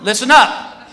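guard addressing a whole line; public announcement. Retold or imitated. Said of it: "he goes to this whole security line".

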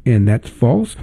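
usual speaking voice

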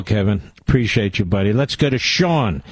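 ordinary talk

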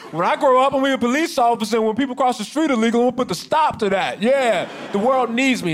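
hammed-up childish earnestness; mock child with a dream. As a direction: imitate a kid proudly announcing a career. Mocks cop's childhood dream.

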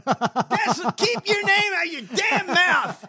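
loud, high, laughing yell; gleeful shouting. Real, not acted. Host echoing candidate's comeback, gleeful shouting. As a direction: crow the line back in delight.

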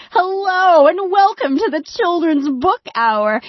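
mock cheerful children's radio host; audiobook announcer. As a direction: chirp it like a kids' audiobook host. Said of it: "the next thing that happens will be".

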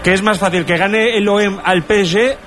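usual speaking voice

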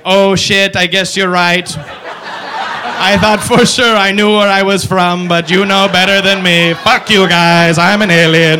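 slow, loud robot voice giving in; the alien grudgingly concedes; slow robotic alien. Retold or imitated. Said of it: "the alien's like".

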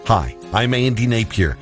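ordinary talk, nothing marked

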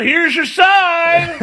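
catchphrase shout. Pretend. Host urging Bill to shout his catchphrase, slow and laughing. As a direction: ham up the famous punchline slowly. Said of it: "You should have shouted out".

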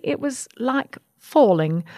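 usual speaking voice

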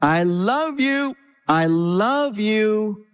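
slow, stretched high "I love you"; father calling love to the baby in the womb; high drawn-out call. Retold or imitated. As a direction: sing it out long to the baby. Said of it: "I would yell".

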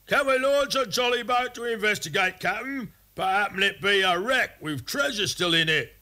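very high-pitched sailor's plea to the captain; high, excited crew voice; eager pirate crewman. Acted. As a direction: pipe up high, keen to go exploring.

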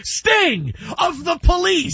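high, drawn-out comic disbelief; mock incredulous. Host acts out the TV show's [name] scene, mock incredulous. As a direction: stretch the name out in high-pitched astonishment. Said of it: "[name] thought [name] was the killer".

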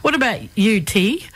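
ordinary delivery

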